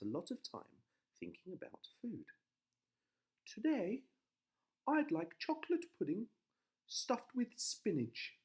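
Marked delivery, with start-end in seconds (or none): 3.5-8.4 high, excited wife voice; chirpy high wife. A narrator's reading.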